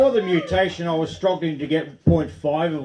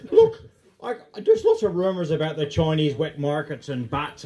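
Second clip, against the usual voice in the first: put-on Irish accent. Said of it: "I'm losing my Irish accent".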